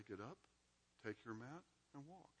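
hushed get up, take your mat; softly voiced Jesus posing the question; soft quoted command. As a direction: lay the command down quietly, as a mere example.

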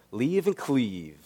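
friend slowly savors leave and cleave in a Bronx voice; slow savoring Bronx echo. Retold or imitated. Bronx friend tries out the phrase, slow and savoring. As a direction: let the new phrase roll out slowly, pleased. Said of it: "And he says".

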